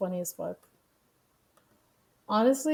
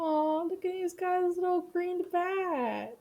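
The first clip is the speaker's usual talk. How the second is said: cutesy cooing; 10 semitones higher, slower than usual. Real, not acted. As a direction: coo over the tiny green bat like it's adorable. Cooing at a kid's bat.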